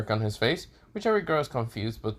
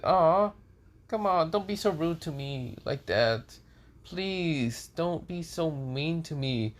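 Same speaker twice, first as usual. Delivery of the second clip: higher-pitched, drawn-out mock pleading; playful pleading; 5 semitones higher, slower than usual. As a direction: wheedle like someone acting wounded. Issei pleads playfully with girl.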